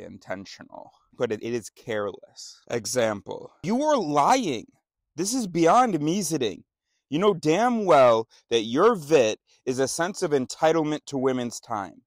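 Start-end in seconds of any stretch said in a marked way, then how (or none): 3.6-12.0 angry accusation. Acted. Accusing someone of lying about their motives, angry and loud. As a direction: confront a liar head-on, forcefully.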